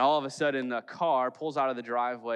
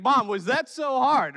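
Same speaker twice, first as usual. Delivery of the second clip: put-on high, loud smug kid voice; a boy taunting his mother; gloating kid; 11 semitones higher, 7 dB louder than usual. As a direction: gloat like a kid proven right.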